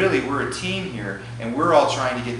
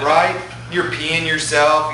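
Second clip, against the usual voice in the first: ordinary talk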